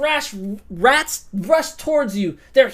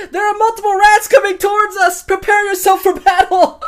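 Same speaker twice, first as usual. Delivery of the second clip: higher, louder call to allies; shouted warning; 6 semitones higher, 5 dB louder than usual. Acted. Melisandre calling to Ramsay and Robert, shouted warning. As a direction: warn the party to arm themselves. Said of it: "I shout to Ramsay Bolton".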